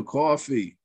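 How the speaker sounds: coffee with a New Jersey vowel, "cawfee"; New Jersey accent. Shown as a demonstration. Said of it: "I speak with a New Jersey accent".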